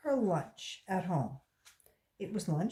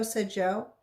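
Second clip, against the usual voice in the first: ordinary delivery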